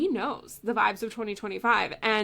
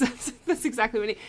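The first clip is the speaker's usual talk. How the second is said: ordinary talk, nothing marked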